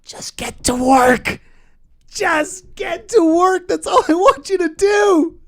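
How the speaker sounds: high, loud, strained yell; frustrated yell. Acted. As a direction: yell it out in exasperation. Frustrated yelling at worker.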